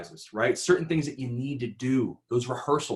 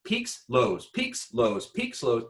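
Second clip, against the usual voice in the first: Peaks jumps much higher, lows drops back down; roller coaster pitch; 10 semitones higher, much slower than usual. Shown as a demonstration. Coach showing workshop a roller-coaster intonation. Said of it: "imagine your intonation kinda like a roller coaster".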